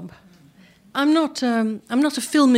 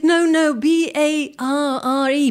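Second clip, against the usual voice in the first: insistent correction; 7 semitones higher, 4 dB louder, slower than usual. Retold or imitated. Director corrects the misunderstood bar.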